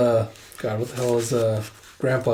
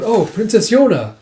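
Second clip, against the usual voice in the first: high, loud exclamation; eager calling out; 8 semitones higher, 6 dB louder than usual. Acted. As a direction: hail the princess eagerly. Taejun calls out to princess.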